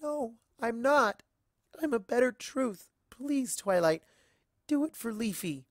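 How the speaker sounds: crying and begging not to be left; tearful plea. Acted. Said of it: "She begins to cry".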